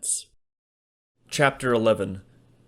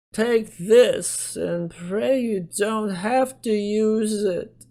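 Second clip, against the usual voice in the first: put-on much higher, slow old lady voice; grave old Gladys; 12 semitones higher, 3 dB louder, much slower than usual. A narrator's reading. Gladys gives Leonard a pouch, grave. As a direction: hand it over with grave care.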